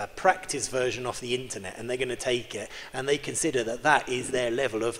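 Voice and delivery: ordinary delivery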